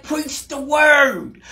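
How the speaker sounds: "Preach the word" high and drawn out; emphatic charge. Acted. Preacher quoting the charge to church, "Preach the word" in slow, high emphasis. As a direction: stretch every word of "Preach the word" slowly, high. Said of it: "Why is he emphasizing on".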